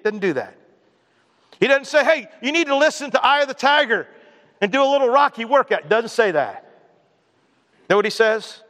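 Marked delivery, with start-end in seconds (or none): 2.0-5.9 high-pitched, hammed-up pep talk; mock motivational coaching. Preacher parodies a pep talk God never gives, mock coaching.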